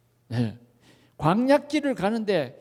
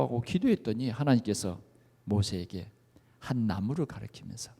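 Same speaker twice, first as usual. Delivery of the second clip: normal talking voice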